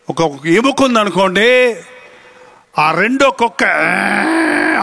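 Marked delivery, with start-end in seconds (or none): 3.6-4.8 long drawn-out dog snarl; imitated dog growl. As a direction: growl like a dog eyeing a bone. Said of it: "అంటది".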